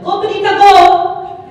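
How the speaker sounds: loud, high Saint Lucian cheer from the stands; shouting Go in a Saint Lucian accent; mom's Saint Lucian yell. Retold or imitated. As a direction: yell it like a proud Caribbean mom. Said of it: "yelling in her Saint Lucian accent".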